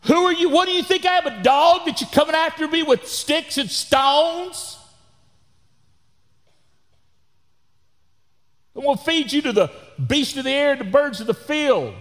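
high, loud mocking giant Goliath; hammed-up Goliath taunt, high and loud. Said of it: "he taunted David".

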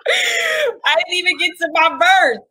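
high, laughing complaint; laughing protest. Real, not acted.

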